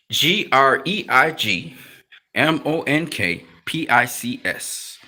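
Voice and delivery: slow letter-by-letter spelling. Shown as a demonstration. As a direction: spell each letter clearly for viewers. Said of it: "you have to type in".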